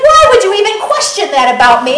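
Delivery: high, loud, rapid protest; indignant disbelief. Acted. Buffet lover questioned about his ability, loudly indignant. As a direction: sound affronted at being questioned.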